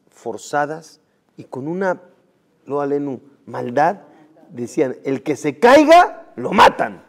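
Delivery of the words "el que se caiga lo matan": harsh command. Acted. Nazis' order.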